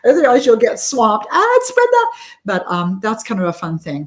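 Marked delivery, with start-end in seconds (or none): 1.3-2.0 high, louder squeal of a starstruck fan; excited fan. Pretend. Imagined fan spots author Brenda, squealing excitedly. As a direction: shriek like you spotted a celebrity.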